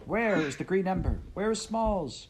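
high, loud repeated demands; shouting. Acted. Heather shouting at Kyle, furious. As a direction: keep yelling at him.

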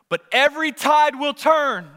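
loud rallying cry; rallying preaching. Acted. Preacher rallying families like a team, loud. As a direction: push each word out hard.

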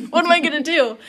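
high, quick, laughing through the words; giggling mock panic; laughing quote. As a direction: laugh at your own worry. Joking about meeting spouse.

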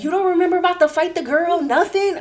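high, fast mock incredulous friend; imitating a friend's high-pitched disbelief; amazed disbelief. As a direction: sound stunned she forgot everything. Friend amazed she forgot fight.

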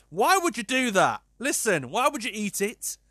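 exasperated and disbelieving; incredulous. Real, not acted. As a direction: demand an explanation, appalled. Incredulous rant at story's woman.

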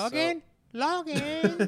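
soft, high calling voice; distant ex's mom. Retold or imitated. Ex's mom calls to the frozen man from a distance, soft and high. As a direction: imitate her calling from far away in falsetto.

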